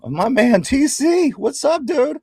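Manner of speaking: loud, high hype shout-out; excited greeting. Real, not acted. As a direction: hype up the returning friend. Hyped greeting to a viewer.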